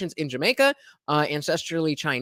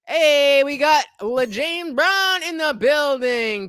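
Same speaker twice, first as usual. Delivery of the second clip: higher, louder, slow announcer-style call; hype welcome shout; 9 semitones higher, 5 dB louder, slower than usual. Real, not acted. Streamer greeting a chatter arriving in his stream, hype shout. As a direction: call it out like a hype man at a door.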